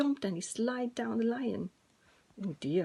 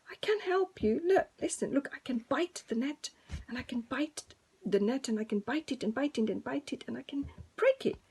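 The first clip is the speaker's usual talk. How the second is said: little mouse; 3 semitones higher than usual. Acted.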